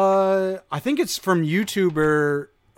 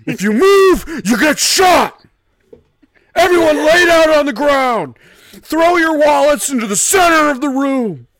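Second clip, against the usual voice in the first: high, loud robber shouting orders; 13 semitones higher, 11 dB louder than usual. Pretend. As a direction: yell commands at a room of hostages.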